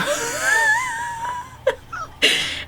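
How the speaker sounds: squealing laugh that cracks up high; real high cackle at herself; high shrieking laugh. Real, not acted. Laughing at her own story.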